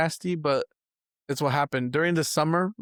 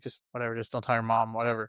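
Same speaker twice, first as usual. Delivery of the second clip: much lower, softer easygoing dad; lazy dad; 6 semitones lower, 6 dB quieter, faster than usual. Retold or imitated. Dad telling his kid, low and softly careless. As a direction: shrug it off like a dad who doesn't care. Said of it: "He'd be like".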